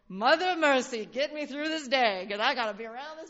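loud, pleading sigh to heaven; mock exasperated prayer. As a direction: beg for patience, hammed up. Mock prayer enduring a difficult person.

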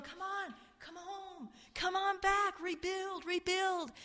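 high, hushed beckoning; King Cyrus coaxing. Retold or imitated. Cyrus calls captives home, softly coaxing. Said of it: "King Cyrus here telling him".

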